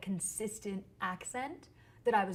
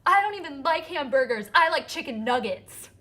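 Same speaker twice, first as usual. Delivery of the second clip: put-on louder, higher childish retort, as if it wins the fight; proud comeback; 7 semitones higher, 12 dB louder than usual. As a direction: deliver the kid's comeback loud and triumphant, slowing at the end. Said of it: "this was my comeback".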